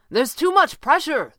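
high, loud, drawn-out protest. A narrator's reading. Red Panda answering the stranger's plan, protesting loudly. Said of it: "the Red Panda protested".

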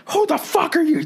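high, hammed-up screeching neighbor; screaming neighbor. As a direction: shriek it like an outraged guy. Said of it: "Just screaming".

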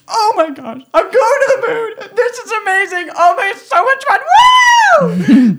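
put-on squealing, high-pitched child's glee; excited child. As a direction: laugh and squeal like a thrilled kid. Child playing in cardboard rocket.

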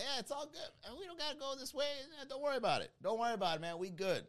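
high-pitched, hurried backing down; mock placating. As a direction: play the harmless guy waving off a fight. Placating an aggressor, voice raised high.

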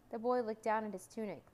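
plain reading voice

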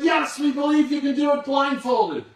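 high, loud crowd reply; crowd answering. Retold or imitated. Crowd answering the tightrope walker, high and loud. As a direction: call back as the believing crowd. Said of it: "They said".

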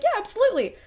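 high, loud mock eagerness; enthusiastic yes. Retold keen reply to cousin.

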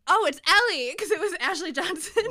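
high, loud thrilled discovery; excited realization. Real, not acted. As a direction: blurt it out in delight. Realizing the actress is Ellie.

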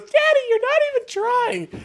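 very high put-on child voice; mock whiny daughter. Daughter scolding her clone trooper dad, high mock whine. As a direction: squeal it like a disappointed little girl. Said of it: "And then she goes".

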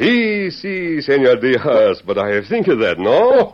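high, bright put-on Mexican accent, playful; imitating Diaz. Lone Ranger copies Diaz's Mexican accent to his face. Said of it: "it is almost myself who is speaking".

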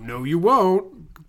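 very slow, drawn-out dramatic refusal; Clive Owen. Acted. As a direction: boom it out as a hammy movie hero. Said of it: "And then Clive Owen comes out".

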